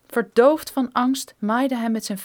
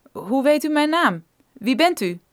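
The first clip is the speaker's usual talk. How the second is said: startled geologist; 3 semitones higher, 5 dB louder than usual. Acted. Brophy confronted by strangers.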